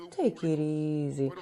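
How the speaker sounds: slow, low, soft placating; soothing wife. Acted. Preacher voicing a wife calming her man, soothing. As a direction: hush him gently, drawn out and low.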